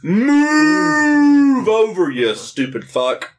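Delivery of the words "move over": long, high, loud cow-like moo on "Move"; mooing wordplay. Pretend. Heckling the bull-man wrestler, mock mooing.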